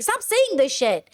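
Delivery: ordinary delivery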